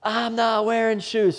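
put-on high, slow stubborn little boy; whiny child. Son refuses again in a high whiny voice. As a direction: whine it like a sulking kid. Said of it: "son's reply".

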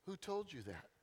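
soft, gentle retort; assistant's quiet pointed question. Retold or imitated. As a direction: quietly ask who told you. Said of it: "just kinda quietly said".

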